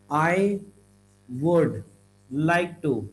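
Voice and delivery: "I would" as separate words with pauses; uncontracted. Shown as a demonstration. Teacher shows the long form to avoid, uncontracted "I would". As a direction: spell out "would" as a full, separate word. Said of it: "No, I'll simply like".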